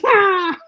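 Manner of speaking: high, loud "aah" of pain; mock scream. Reacting to the stinger in her head, mock screaming.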